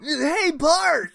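high, loud nerdy call; cartoon kid shouting out in a nerd voice; Milhouse. Host tries a cartoon nerd impression, high loud call. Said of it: "too cool for Millhouse's voice".

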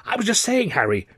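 high, gushing enthusiasm; eager, high-pitched praise; enthusiastic cry. A narrator's reading. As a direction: cry it out brightly, starting high. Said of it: "cried Buxton enthusiastically".

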